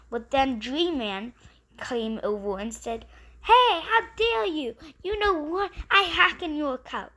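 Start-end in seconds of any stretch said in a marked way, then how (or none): 3.4-7.1 higher, louder outraged yell; hammed-up fury at the cheater; a kid voicing a furious gamer; angry shout. Dream Man confronts the thief.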